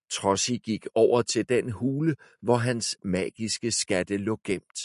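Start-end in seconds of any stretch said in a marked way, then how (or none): none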